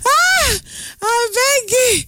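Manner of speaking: high, slow pleading swoon; overcome. Real, not acted. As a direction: beg as if overwhelmed.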